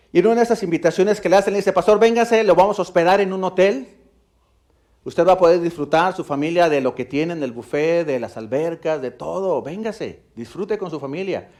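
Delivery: loud, high, fast storytelling from the pulpit; fervent preaching. Acted. Preacher voicing the host's inviting call, fervent and loud. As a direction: drive the story to the whole church.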